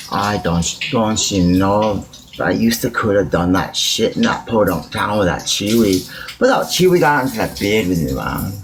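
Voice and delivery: hint of a rural north country accent. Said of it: "Let me do a north country accent".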